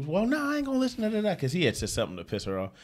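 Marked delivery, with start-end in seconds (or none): 0.1-1.4 high, fast indignant woman's voice; angry woman on phone. Retold or imitated. Retold caller refusing prank lawyer, high fast indignant voice. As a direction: snap back fast and high like an offended caller. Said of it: "And she was like".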